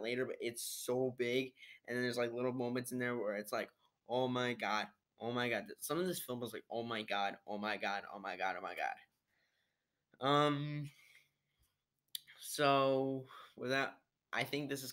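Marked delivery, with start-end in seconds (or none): none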